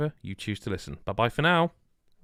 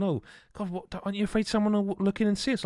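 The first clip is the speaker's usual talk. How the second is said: imitating wife; 8 semitones higher than usual. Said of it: "my wife's gone".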